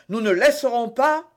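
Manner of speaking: high, loud protest; exclamation. A narrator's reading. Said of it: "s'écria Porthos". (protestation vive, voix haute)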